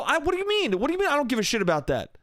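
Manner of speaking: high, fast incredulous pushback; indignant retort. Retold or imitated. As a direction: re-enact being baffled and annoyed. Retold argument at pool table.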